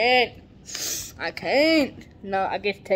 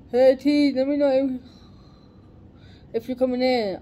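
loud, higher call to a coworker; 5 semitones higher, 9 dB louder, faster than usual. Acted. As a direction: call across to T. Calling out to coworker.

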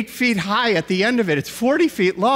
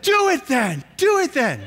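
mock kid voice, higher, daring dad; 6 semitones higher than usual. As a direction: egg him on like an eager kid. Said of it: "the next word said".

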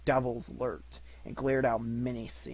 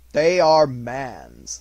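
slow, loud solemn spirit; loud grave ghost; 13 dB louder, much slower than usual. Acted. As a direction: pronounce it slowly like a verdict. Spirit answers Scrooge gravely.

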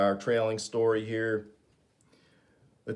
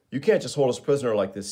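protesting; 4 semitones higher, 7 dB louder, faster than usual. Acted. Flash protests to Steele.

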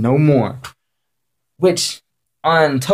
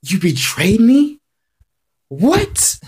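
outraged disbelief; 8 semitones higher, much slower than usual. Retold or imitated. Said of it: "Frost was so pissed".

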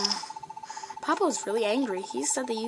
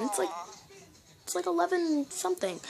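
usual speaking voice

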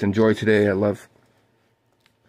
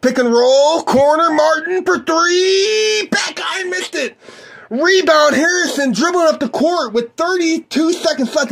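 high, loud, stretched-out call; excited shout; 3 dB louder, slower than usual. Acted. Calling the corner three to listeners, excited high shout. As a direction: shout the shot like it matters. Shot and rebound called live.

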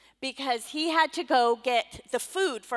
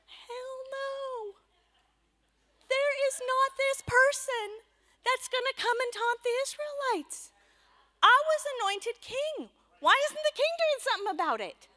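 much higher voice, bold and indignant; defiant young David; 14 semitones higher than usual. Acted. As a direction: rise up like a fearless shepherd boy. David's defiant outburst, acted.

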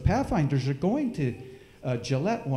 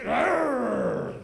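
bear growl; 10 semitones higher than usual. Pretend. Sneaking up on his cousin again, pretend bear growl. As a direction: pretend growling arr, pitched up. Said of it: "and I'd go".

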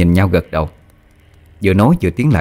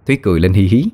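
ordinary delivery, nothing marked

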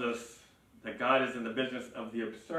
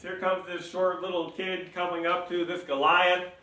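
usual speaking voice

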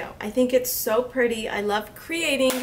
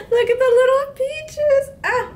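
high, loud gushing; 11 semitones higher, 6 dB louder than usual. Real, not acted. Gushing over peaches.

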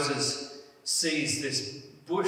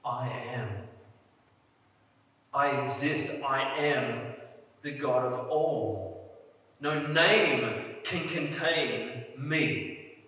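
voice of God; slower than usual. Retold or imitated. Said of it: "He just says".

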